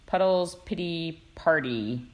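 ordinary talk, nothing marked

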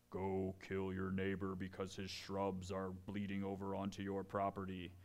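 put-on hushed, droning mystical command; bogus divine voice. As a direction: intone it on one low note like a fake prophecy. Voicing a bogus word from God.

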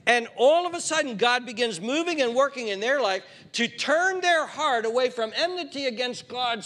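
loud, high, driving sermon voice; full-force preaching. Acted. Pastor pressing the congregation about sin, loud preaching.

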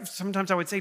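normal talking voice

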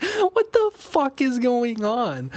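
high, drawn-out squeal of amused disbelief; laughing disbelief. Retold or imitated. Gamer telling friends about his weird horse, laughing disbelief. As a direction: cry it out slowly, high and loud through laughter. Reacting to the absurd slow horse.

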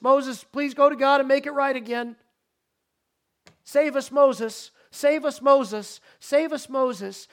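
loud, high begging cry to Moses; pleading Israelites. Acted. Israelites to Moses, pleading loudly for rescue. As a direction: beg Moses again and again to save you.

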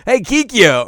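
loud, high call; wrong-name call. Retold or imitated. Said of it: "Inuyasha immediately was like".

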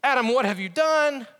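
calling out. Acted. God calling to Adam in the garden, calling out. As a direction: call out searchingly, drawing out the words. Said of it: "God calls out to Adam".

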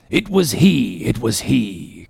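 understated eager outburst; a touch of breathless recognition; mild cry. Acted. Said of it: "cried Ahab".

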